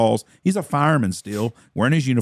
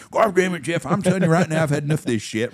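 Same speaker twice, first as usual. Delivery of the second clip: mock angry dad; 4 semitones higher, faster than usual. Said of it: "dad yelling at son".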